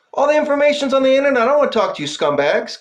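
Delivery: fast angry rant pitched high; angry outburst. Real, not acted. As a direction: fire it off at the cops, fast and high. Ranting at the police.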